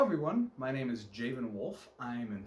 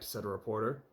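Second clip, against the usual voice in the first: plain reading voice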